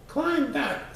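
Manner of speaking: high, sharp bug voice giving an order; squeaky insect snapping a command; bossy bug command. A narrator's reading. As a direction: point up and bark it like a tiny boss. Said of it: "said the bug".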